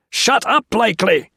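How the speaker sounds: very high, loud scream. A narrator's reading. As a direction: scream him silent. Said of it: "Jones screamed".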